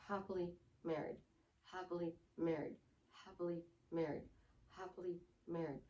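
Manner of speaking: low, soft, evenly spaced robotic drone; monotone. Shown as a demonstration. Coach trying to affirm without emotion, monotone. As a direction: repeat it on one note with no feeling. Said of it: "Monotone voice".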